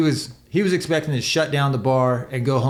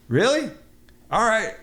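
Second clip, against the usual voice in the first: higher-pitched fake-innocent really, all right; mock sarcastic; 7 semitones higher than usual. Rider answering bartender before chucking glasses, sarcastic higher voice. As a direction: play it sarcastically agreeable, about to throw the glasses.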